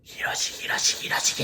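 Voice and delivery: ghostly whispered Hiroshi, Hiroshi; whispering Hiroshi before it turns to a scream. Retold or imitated. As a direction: hiss the name Hiroshi over and over. Ghost woman calling the name.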